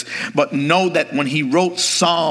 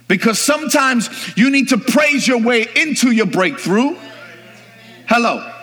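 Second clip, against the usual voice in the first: very high-pitched, shouted exhortation; impassioned pulpit shout, driven and insistent; full-force preaching; 13 semitones higher, 3 dB louder than usual. Real, not acted. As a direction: cry it out to rouse the congregation.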